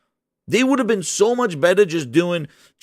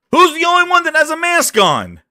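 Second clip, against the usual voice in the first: high, loud incredulous question; raised voice, pitched way up; indignant outburst; 10 semitones higher, 5 dB louder than usual. Real, not acted. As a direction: call it out in disbelief. Indignant outburst at promo image.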